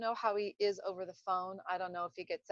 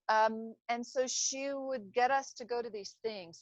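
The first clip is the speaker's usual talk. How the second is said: usual speaking voice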